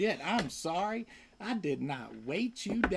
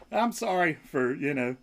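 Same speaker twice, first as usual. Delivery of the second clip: normal talking voice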